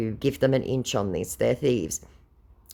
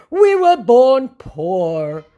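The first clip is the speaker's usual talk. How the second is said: very slow, high, louder mock lament; mock scammer plea; 11 semitones higher, 9 dB louder, much slower than usual. Scammer pleading poverty, slow and hammed-up. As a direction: drag it out like a sorry excuse.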